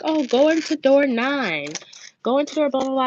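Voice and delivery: a hint of a school lady; lady giving directions. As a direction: lift the pitch for breezy directions. Voices lady giving directions by phone.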